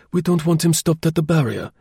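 high-pitched, commanding character voice; curt, authoritative. A narrator's reading. Chauvelin takes command of the little party, curt and authoritative. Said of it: "in his usual curt, authoritative manner".

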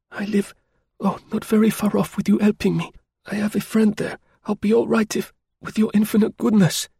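cling to his kindness as she begs; wounded woman pleading. A narrator's reading. Wounded woman voiced throughout.

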